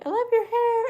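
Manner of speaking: put-on high, bright gushing girl voice; quoted girl. Girl in chat gushing over her hair, high squeal. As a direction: squeal it like an excited fan. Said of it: "some girl will be like".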